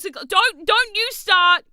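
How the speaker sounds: high, loud mock warning; warning chat. Real, not acted. Streamer loudly warning chat not to start. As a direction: wag a finger at them with your voice.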